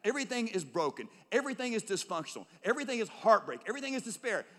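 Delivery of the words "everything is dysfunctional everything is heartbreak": ordinary talk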